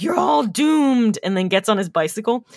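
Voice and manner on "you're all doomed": slow, ominous doom warning; slow ominous raspy drawl. Retold or imitated. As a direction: drawl it like a crazy old prophet. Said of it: "He's like".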